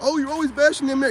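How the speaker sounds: high mocking whine; mocking women. Vlogger mocking women online, high whiny mimic. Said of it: "y'all over here talking like".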